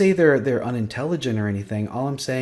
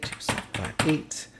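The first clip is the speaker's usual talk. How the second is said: whispering the number back to himself; whispered repeat; 3 semitones higher, 7 dB quieter than usual. Shown as a demonstration. Tutor checking a number under his breath, whispering. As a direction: murmur the digits as you check them.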